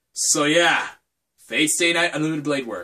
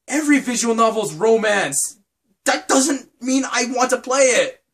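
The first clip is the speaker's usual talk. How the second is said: higher, mildly exasperated comeback; retold protest; 6 semitones higher than usual. Vlogger answering fans who push romance games, mild exasperated protest. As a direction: push back at the eager fans. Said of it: "And I'm just like".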